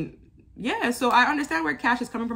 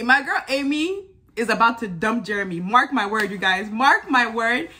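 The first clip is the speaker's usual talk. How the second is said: ordinary delivery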